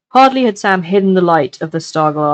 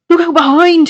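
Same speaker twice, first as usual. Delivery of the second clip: high, loud cry of alarm; yelled warning; 11 semitones higher, 5 dB louder than usual. A narrator's reading. Sam crying out to warn Frodo, loud yell. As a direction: shout the warning with all your breath. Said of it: "he yelled".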